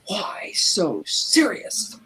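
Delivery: slow, whispered villain taunt; menacing whisper. Retold or imitated. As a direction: breathe it out slowly and menacingly. Joker's line to the child.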